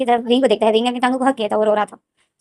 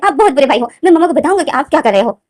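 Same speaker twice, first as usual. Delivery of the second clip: a child's mock angry threat, a bit louder; sulky kid threatening to tell mom; 5 semitones higher, 5 dB louder than usual. Angry little brother threatening big brother, mild childish rage. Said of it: "लेंजन गुस्से में आ जाता है".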